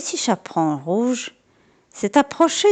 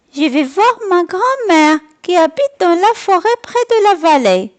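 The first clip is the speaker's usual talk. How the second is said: put-on higher, innocent little girl; little girl's voice; 8 semitones higher, 4 dB louder than usual. A narrator's reading. Girl answering the wolf in the woods, innocently. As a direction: answer like a trusting young girl. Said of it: "lui répondit Petit Chaperon Rouge".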